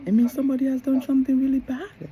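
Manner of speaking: loud, high and quick retold warning; mock yelling, fast and high. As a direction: raise your voice to drive the point home. Explains when she yells at home.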